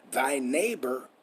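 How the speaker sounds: ordinary delivery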